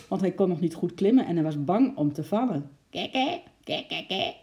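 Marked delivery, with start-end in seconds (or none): 2.9-4.3 high-pitched magpie cackle; screeching magpie. A narrator's reading. Magpie menacing a young squirrel, screeching. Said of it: "krijste de ekster".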